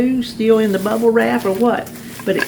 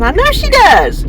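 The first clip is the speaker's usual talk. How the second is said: high-pitched, emphatic comeback; animated retort; 12 semitones higher, 4 dB louder than usual. Retold or imitated. Wife retells answering husband about Alexa, animated retort. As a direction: shoot it back high, playing up the retold retort.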